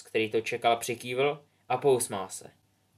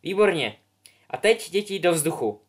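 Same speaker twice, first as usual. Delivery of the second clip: higher, louder teacher's order; commanding teacher; 5 semitones higher, 6 dB louder than usual. Acted. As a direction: send them up. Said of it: "přikázala jim madam Hoočová".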